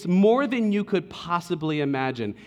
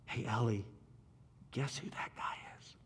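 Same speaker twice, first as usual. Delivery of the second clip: hushed aside to his wife; whisper; 5 semitones lower, 16 dB quieter than usual. Retold or imitated. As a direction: whisper it so the guy can't hear. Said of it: "I lean back and I'm like".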